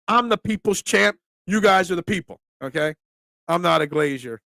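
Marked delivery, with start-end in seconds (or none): none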